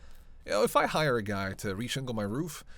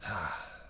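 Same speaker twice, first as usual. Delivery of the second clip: low, soft falling sigh; 5 semitones lower, 5 dB quieter than usual. Acted. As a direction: let out a soft breath. Lone sigh between lines.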